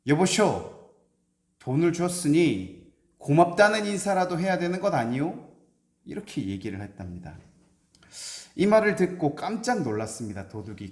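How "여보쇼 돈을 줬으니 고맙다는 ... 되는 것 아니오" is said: louder, higher call after him. Acted. Scholar calling after the departing robber, loud and high. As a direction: call out loudly to stop him leaving. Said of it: "이렇게 소리를 쳤습니다".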